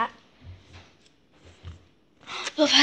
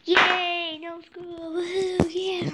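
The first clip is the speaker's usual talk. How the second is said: cheering; 7 semitones higher, much slower than usual. Real, not acted. Cheering about school closing.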